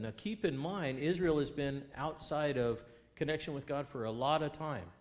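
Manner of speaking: normal talking voice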